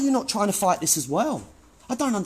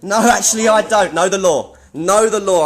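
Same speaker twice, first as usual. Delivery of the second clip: loud, insistent rebuttal; raised voice; 2 semitones higher, 8 dB louder than usual. Real, not acted. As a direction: shout them down with the law. Arguing with staff over filming.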